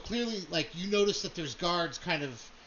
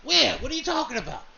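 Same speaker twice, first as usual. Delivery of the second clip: higher, louder startled question; alarmed Where; 7 semitones higher, 6 dB louder, faster than usual. Acted. Krabs spinning round at the whisper, high startled blurt. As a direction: Krabs blurts out, looking around.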